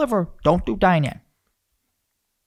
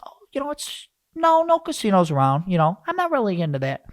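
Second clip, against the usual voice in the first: lightly voiced prim aunt, high start; a hint of a polite aunt; aunt's voice; 7 semitones higher than usual.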